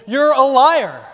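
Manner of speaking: slow, loud, high accusation of a liar; mock accuser spitting out liar; accusing. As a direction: hurl it at them like an insult.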